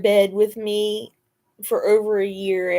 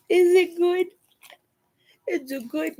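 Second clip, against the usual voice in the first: pet talk; 8 semitones higher than usual. Real, not acted. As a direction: coo at the dog. Asking the dog.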